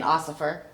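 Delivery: Ossifer, with the middle sounds swapped; mock-drunk Ossifer, s before f; tipsy mispronunciation. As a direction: say Ossifer like a tipsy slip for officer. Drunk driver denies drinking to police.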